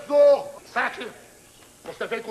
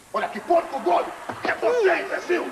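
high-pitched angry insults; comic rage, pitch shooting up; enraged outburst; 8 semitones higher than usual. Acted. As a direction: hurl the insults, voice climbing high. Enraged sergeant starts beating the sack.

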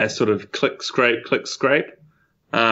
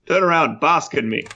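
higher-pitched commanding call; pompous shout; 5 semitones higher than usual. Acted. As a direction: demand an audience, punching the last word. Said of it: "he shouts".

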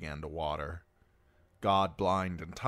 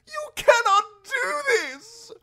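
high, slow, very loud protest; desperate shout; 12 dB louder, slower than usual. A narrator's reading. Prisoner shouts at guards' retreating backs, desperate. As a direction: shout after them, stretching the words. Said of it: "the prisoner shouted".

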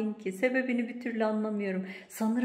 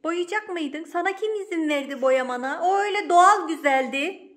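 raised, high-pitched reproach; indignant scolding; 7 semitones higher, 7 dB louder than usual. Real, not acted. Owner objecting to her jug being painted, indignant scolding. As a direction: demand to know who allowed it.

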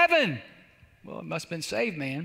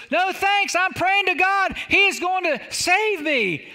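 high, slow acted call; confident refusal hollered from a distance; calling back; 8 semitones higher, 5 dB louder, slower than usual. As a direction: stretch it out like calling across water. Stranded man calls back his refusal.

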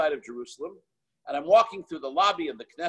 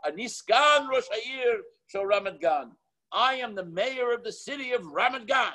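lightly voiced Israeli, higher and slow; a hint of the Israeli official stating his title; Israeli guy; 7 semitones higher, slower than usual. Itzik voiced in story.

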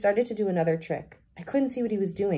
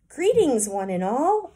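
higher, louder hamster greeting; loud squeak; 7 semitones higher, 6 dB louder, slower than usual. A narrator's reading. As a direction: squeak it out as loud as you can. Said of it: "I squeaked as loudly as I could".

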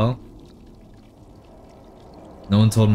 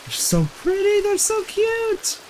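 very high, slow cutesy coo. Real, not acted. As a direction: coo at the cats like they're babies. Cooing at cute cats in game.

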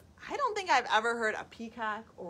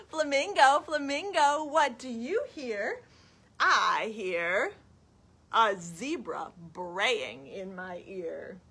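put-on reading to children; 5 dB louder, slower than usual. Storytime question and answer about a zebra, lilting. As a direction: chant the question, draw out the answer. Reads picture book to children.

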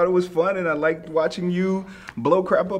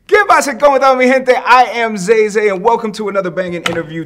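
hype host intro; 4 semitones higher, 8 dB louder than usual. Acted. Host opens the show.

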